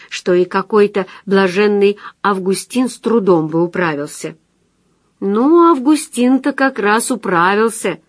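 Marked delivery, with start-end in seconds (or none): 5.2-8.0 raised, louder, lightly amused comeback; amused retort. A narrator's reading. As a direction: toss it back as if about to laugh, no real laugh. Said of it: "рассмеявшись".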